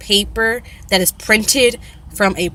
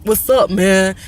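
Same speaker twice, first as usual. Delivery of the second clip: mock slang; slower than usual. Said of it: "We don't say".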